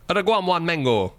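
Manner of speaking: hammy bad Jamaican accent. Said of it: "It's my bad Jamaican accent".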